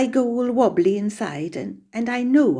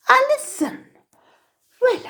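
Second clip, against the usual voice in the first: high, surprised cry of a name; astonished recognition. Acted. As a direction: gasp out the name as you spot who wrote. Letter's sender discovered after long silence.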